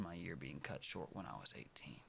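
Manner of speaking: hushed, unvoiced confession; whisper. Real, not acted. To the viewers about dying young, whispered. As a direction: breathe it out softly.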